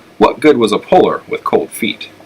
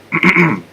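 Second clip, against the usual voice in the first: a short, high throat clear before speaking; 7 semitones higher than usual. A narrator's reading. Oscar clears his throat before answering Flora, a quick high ahem. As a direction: clear your throat to get attention. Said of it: "Oscar cleared his throat".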